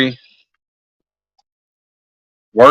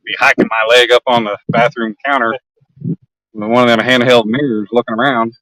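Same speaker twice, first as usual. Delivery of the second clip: normal talking voice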